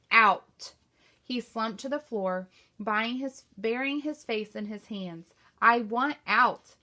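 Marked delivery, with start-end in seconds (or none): 0.1-0.7 one word hurled much louder; shout. A narrator's reading. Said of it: "he pounded his fist against the tree trunk". 5.6-6.7 slow, louder, breaking moan; a broken man giving up; moaning despair. A narrator's reading. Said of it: "He uttered a moan".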